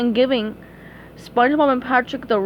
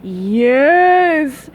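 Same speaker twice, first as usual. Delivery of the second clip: louder villain hiss; louder gloating villain hiss; hissed yes; 2 semitones higher, 6 dB louder than usual. Acted. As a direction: hiss it gleefully. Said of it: "hissed Plankton".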